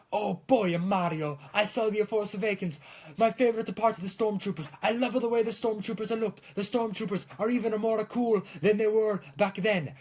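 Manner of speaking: gushing fan in a put-on Italian accent; excited Italian-accented retelling. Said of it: "I can stop talking in this accent".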